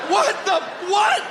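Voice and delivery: very high, stunned "what the, what"; baffled shriek. Retold or imitated. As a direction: shriek in total confusion. Dad baffled by question.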